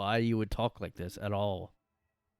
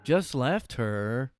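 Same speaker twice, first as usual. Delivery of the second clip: mock narrator; 3 semitones higher, 5 dB louder, much slower than usual. Creator repeating the narrator's line, very slow. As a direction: echo the line in the narrator's stretched cadence. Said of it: "parroting narrator line".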